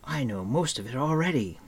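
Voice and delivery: mildly boastful call; a touch of youthful bragging. A narrator's reading. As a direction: show off eagerly. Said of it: "he called".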